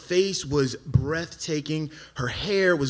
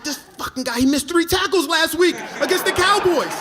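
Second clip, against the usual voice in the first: high, loud outraged rant; mad; 12 semitones higher, 6 dB louder than usual. Retold or imitated. Jealous man ranting about his friend's football record, mad. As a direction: build to an indignant shout. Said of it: "I'm so mad".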